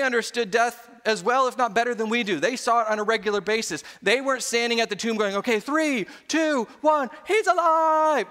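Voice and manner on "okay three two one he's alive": countdown climbing high into a shout; mock excited countdown. Disciples waiting at the tomb for the resurrection, mock excited countdown. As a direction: count down and burst out loudly, mock jubilant.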